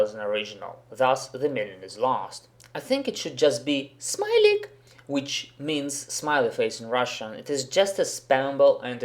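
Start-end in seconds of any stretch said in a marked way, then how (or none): 4.0-4.7 very high-pitched, annoying game-character squeal; obnoxious voice. Shown as a demonstration. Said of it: "in an obnoxious voice".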